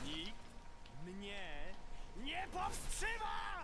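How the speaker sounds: triumphant shout. Acted. Game character shouts triumphantly.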